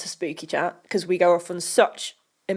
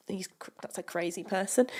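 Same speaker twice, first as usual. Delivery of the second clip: quick unvoiced whisper; whispered aside; 3 semitones lower, 11 dB quieter, faster than usual. Real, not acted. Whispered aside about commenter.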